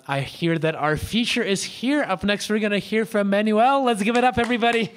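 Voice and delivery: hyping the crowd. Real, not acted. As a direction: pump up the room for the next act. Host introduces next performer.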